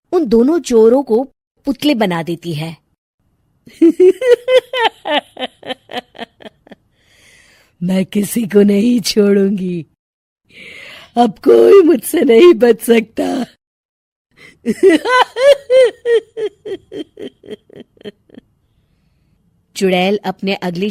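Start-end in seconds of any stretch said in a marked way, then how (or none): 3.8-6.2 high, loud evil cackle; witch cackle. Acted. Witch cackles after turning the thieves into statues. 7.8-13.5 loud, threatening witch voice; menacing witch. Acted. Witch vows to the night that nobody will escape her. 14.6-17.3 very high, loud evil cackle; witch cackle. Acted. Witch cackles after her threat, loud and evil.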